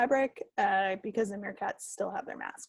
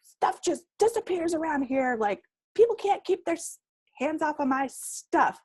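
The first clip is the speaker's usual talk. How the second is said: higher-pitched, mock-exasperated rant; stomping-mad grumble; 6 semitones higher than usual. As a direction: complain in a huff, imitating your own tantrum. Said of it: "literally stomping around".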